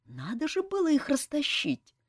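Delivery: high-pitched, apologetic excuse; guilty explanation. A narrator's reading. Commander explains separating the fighters, guilty. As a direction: explain yourself guiltily to a superior. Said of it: "виновато объяснил командор Фортуната".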